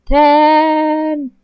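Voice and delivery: loud, high, sung-out call of the name; drawn-out call. Acted. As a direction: stretch the name out in a loud singsong. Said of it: "ignore that drawl".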